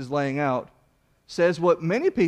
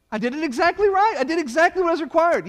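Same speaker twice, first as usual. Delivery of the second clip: high, insistent protest of a wronged worshipper; indignant worshipper; 11 semitones higher, 3 dB louder than usual. Acted. Worshipper protesting to the priest, pitched high and indignant. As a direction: plead your case, sure you did it right.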